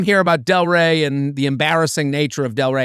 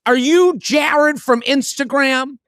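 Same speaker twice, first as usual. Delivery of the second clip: lightly imitated woman, higher and drawn out; slow, raised-pitch mock fan question; excited woman fan; 7 semitones higher, slower than usual. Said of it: "this woman comes up to me".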